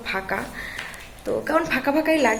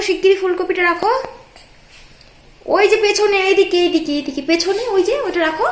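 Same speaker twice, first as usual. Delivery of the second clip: mother cooing at her little daughter, higher; 7 semitones higher, 6 dB louder than usual. Real, not acted. Mother urges toddler to tidy up.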